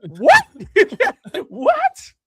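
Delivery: loud, high disbelief breaking into a laugh; incredulous. Real, not acted. Fan reacting to the heel's excuse, loud disbelieving laugh. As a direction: sound stunned, then laugh it off.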